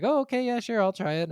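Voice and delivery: high, bright mock-casual agreeing; easygoing agreement. Recalling how he agreed to try the game, high mock-casual yes. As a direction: toss it off like an easy yes. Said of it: "And I was like".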